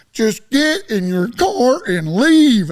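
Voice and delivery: loud, high, slow, drawn-out holler; exasperated shout. Retold or imitated. Fed-up bar owner ordering him out, exasperated shout. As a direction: yell each word like you've had enough.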